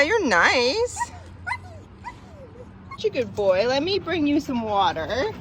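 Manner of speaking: high, sing-song voice for a dog; talking to a dog. Real, not acted. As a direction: coo at the dog like a pet. Greeting a stray dog.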